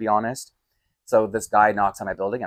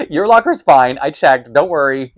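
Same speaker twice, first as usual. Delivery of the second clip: louder, higher, firm reassuring; emphatic reassurance; 4 semitones higher, 9 dB louder than usual. Retold or imitated. Telling Bill his locker is safe, loud and insistent. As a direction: hammer home that his locker is fine. Calming the worried superintendent.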